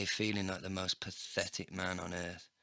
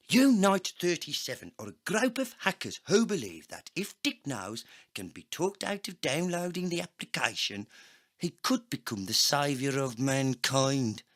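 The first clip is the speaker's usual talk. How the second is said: higher, louder agent voice; cyborg agent voice; 7 semitones higher, 6 dB louder than usual. A narrator's reading. Agent explaining the hacker group, higher and louder. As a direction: explain it like an urgent insider.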